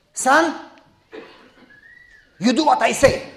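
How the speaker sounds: imitating a domineering old elder; commanding elder. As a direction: talk down to him like a boss. Elder ordering young pastor.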